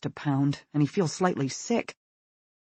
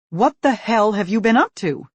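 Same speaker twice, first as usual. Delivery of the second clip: loud, higher angry demand; 7 semitones higher, 9 dB louder than usual. Acted. Mother demands answers from her son, angry and loud. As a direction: demand an answer, holding out the phone. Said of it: "she demands".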